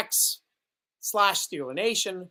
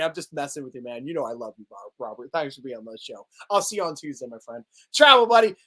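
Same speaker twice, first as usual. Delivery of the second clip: ordinary talk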